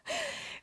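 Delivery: a quick squeaky laugh; short high laugh. Real, not acted. Teacher laughing at her audience, a quick high laugh.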